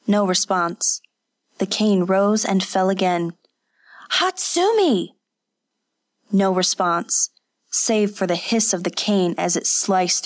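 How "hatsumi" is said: desperate cry. Acted. Second desperate call.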